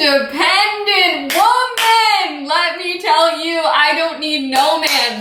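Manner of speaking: high, slow sassy declaration, hammed up; sassy diva. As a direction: belt it out like a proud diva anthem. Sassy self-empowerment declaration.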